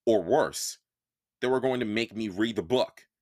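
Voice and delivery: ordinary delivery, nothing marked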